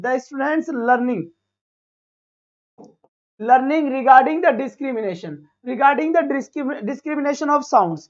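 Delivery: reading the English question very slowly, word by word; slow dictation reading. Shown as a demonstration. Teacher reads the exam question very slowly to students. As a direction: spell the question out slowly for the class.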